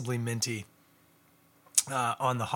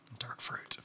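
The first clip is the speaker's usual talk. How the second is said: whisper; 9 dB quieter than usual. Real, not acted. Reads label, drops to whisper.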